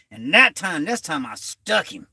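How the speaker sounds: ordinary talk, nothing marked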